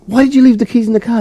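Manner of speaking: high-pitched imitation of a scolding boss; exasperated questioning. Boss questions driver about the keys, exasperated.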